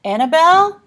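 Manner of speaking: high, loud urgent call; panting. A narrator's reading. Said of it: "panted Olive".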